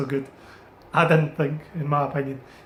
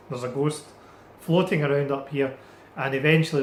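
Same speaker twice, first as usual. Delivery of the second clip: ordinary delivery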